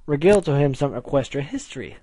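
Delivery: ordinary delivery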